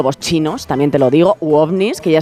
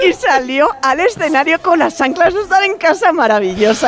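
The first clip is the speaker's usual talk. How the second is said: laughing; 10 semitones higher than usual. Real, not acted. Laughing while telling Karina story.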